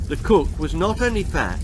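ordinary delivery, nothing marked

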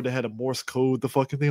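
ordinary talk

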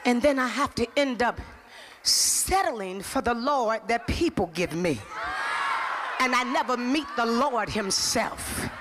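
slow, low-pitched sermon delivery; weighty preaching. Real, not acted. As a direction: preach it slowly, drawing out key words.

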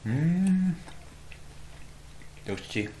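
chewing while talking; muffled through food; mouth full. Real, not acted. Seen 1: Cheeks bulge, lips closed chewing right after spooning meat at -3.

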